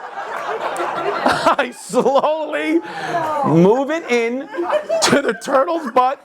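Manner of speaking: slowly, laughing, stretching the words; slow drawn-out. Real, not acted. Telling the audience the absurd climax, slow and laughing. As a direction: savour each word through laughter.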